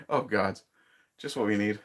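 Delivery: ordinary talk, nothing marked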